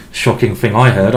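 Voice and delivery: usual speaking voice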